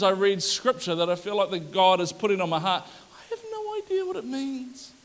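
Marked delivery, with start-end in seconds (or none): 2.8-4.9 mock clueless. Mock cluelessness about scripture.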